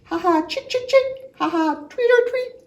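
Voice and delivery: very high, loud chirping; playful bird twitter; swallow chirp. Acted. Reader voices swallow call.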